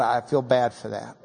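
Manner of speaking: usual speaking voice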